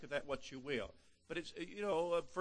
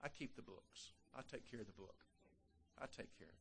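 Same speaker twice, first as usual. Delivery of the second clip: hushed voice of God; 4 semitones lower, 16 dB quieter than usual. Pretend. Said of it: "I'm paraphrasing now".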